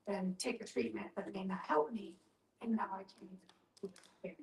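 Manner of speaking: ordinary talk, nothing marked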